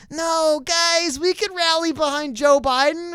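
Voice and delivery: high-pitched mock pleading to supporters; strained, high imitation of a campaign booster; pleading salesman. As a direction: mimic a desperate rallying pitch, voice pushed way up. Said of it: "coming to people and saying".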